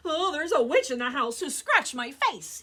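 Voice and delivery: hammed-up scared robber; frightened cry. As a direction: cry out in mock terror to his friends. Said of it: "he cried".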